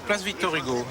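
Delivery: ordinary talk, nothing marked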